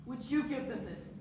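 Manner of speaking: quiet question. Acted. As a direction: ask it almost under the breath. First asking, quiet before outburst.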